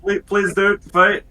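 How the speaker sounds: put-on slow, high pleading in Dwarvish accent. Dwarf begging guards to calm down, Dwarvish accent. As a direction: plead in a hammed-up Dwarvish voice, breaking into laughter. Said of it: "We'll go with the Dwarvish one".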